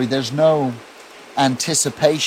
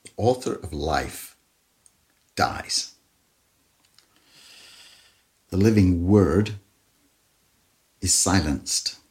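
grave, hushed sermon delivery; hushed solemn preaching; 6 semitones lower, 5 dB quieter than usual. Acted. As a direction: let each phrase sink into silence. Somber climax of crucifixion.

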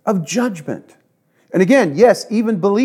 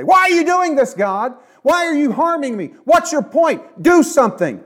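whining complaint; 11 semitones higher than usual. Shown as a demonstration. Preacher voicing a believer who whines and accuses God, high accusing whine. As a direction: hurl a petulant accusation at God. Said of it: "It's another thing to say".

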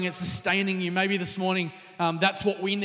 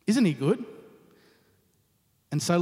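ordinary delivery

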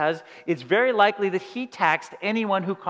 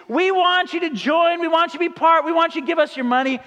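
much higher, keen sales voice; mock company message luring customers in, much higher; eager company pitch; 7 semitones higher than usual. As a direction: oversell the welcome. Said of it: "the message is".

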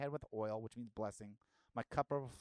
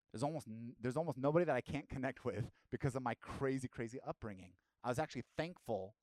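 ordinary talk

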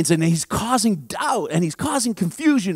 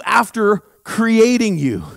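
ordinary talk, nothing marked

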